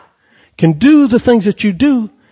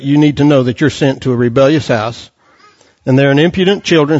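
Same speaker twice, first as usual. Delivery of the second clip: ordinary delivery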